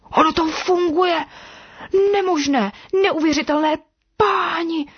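high-pitched, thrilled astonishment; amazed excitement. A narrator's reading. Snake discovers its new legs work.

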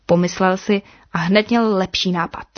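usual speaking voice

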